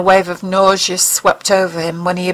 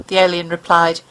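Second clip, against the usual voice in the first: ordinary delivery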